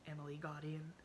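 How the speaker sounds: ordinary delivery, nothing marked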